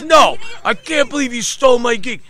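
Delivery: high, shouted impression of an outraged bandmate; furious accusation. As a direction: cut in yelling, accusing him. Acting out Joey's furious accusation.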